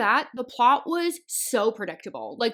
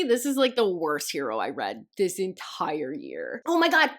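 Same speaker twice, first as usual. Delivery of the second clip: normal talking voice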